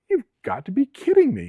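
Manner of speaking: very high-pitched retold disbelief; incredulous. Officer to unhurt driver of wrecked car, incredulous. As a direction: pitch jumps way up in amazement.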